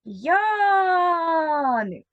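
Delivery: "Яник" hailed with a long stressed "Я"; calling out. Shown as a demonstration. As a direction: call the name out, stretching the first vowel. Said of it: "Для цього погукаємо".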